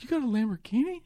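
shocked question, pitched high; can't believe his eyes, ask it high; surprised. Retold or imitated. Friend shocked by the car.

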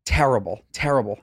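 ordinary delivery

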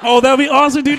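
ordinary delivery

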